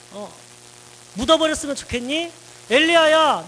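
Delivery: a father's rising rhetorical challenge, easing at the end; God speaking to Elijah. Acted. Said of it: "이렇게 얘기하고 있는 거죠".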